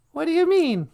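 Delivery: very high, small comic voice; high-voiced sidekick. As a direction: pipe up as the host's curious sidekick. Sidekick figure beside host.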